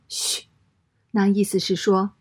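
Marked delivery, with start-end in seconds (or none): 0.1-0.5 soft, drawn-out "shh"; hushing shush. A narrator's reading. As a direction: hush someone discreetly. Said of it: "伸出一个手指头说". (轻声嘘，示意别出声)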